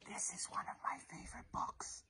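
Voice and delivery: secret hushed whisper. Shown as a demonstration. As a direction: share it like a secret. Teacher whispering to children.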